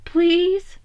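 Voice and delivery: pleading. A narrator's reading. Donnie pleading with Mikey.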